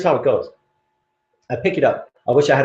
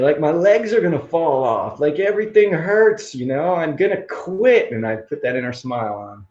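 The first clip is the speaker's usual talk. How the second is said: usual speaking voice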